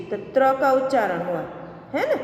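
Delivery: usual speaking voice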